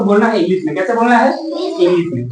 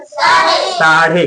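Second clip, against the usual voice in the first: साढ़े stretched very slow; drawn-out answer; 5 semitones lower, much slower than usual. Shown as a demonstration. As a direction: hold साढ़े long so it sinks in. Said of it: "क्या होता है?". (साढ़े बहुत धीरे खींचकर)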